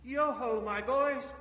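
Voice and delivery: put-on loud, rich jovial call to the boys. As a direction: boom it out like a jolly boss. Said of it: "comfortable, oily, rich, fat, jovial voice".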